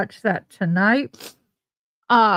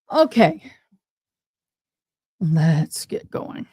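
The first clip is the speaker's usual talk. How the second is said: normal talking voice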